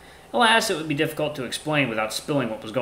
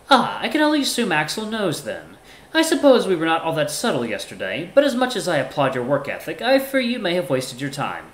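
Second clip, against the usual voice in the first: higher-pitched, measured formal character; courteous Ty; 6 semitones higher than usual. Acted. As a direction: speak up high, politely composed. Voices Ty in story.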